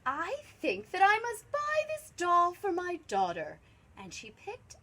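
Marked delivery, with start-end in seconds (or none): 0.0-3.6 theatrical high-voiced woman; gushing lady shopper. A narrator's reading. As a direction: coo it high over a find. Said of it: "She said".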